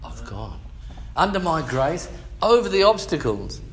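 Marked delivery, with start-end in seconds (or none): 1.1-3.6 loud preaching; preaching God's words. Acted. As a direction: proclaim it like a sermon peak.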